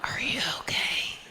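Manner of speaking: hushed, breath-only question; whispered concern. Acted. Daughter to mom about imaginary friend, concerned whisper. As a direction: whisper it as if checking on someone unwell.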